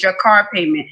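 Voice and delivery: normal talking voice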